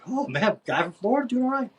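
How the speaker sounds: high-pitched, pleased exclamation; retold self-cheer. Rider passing two dudes, retold self-cheer.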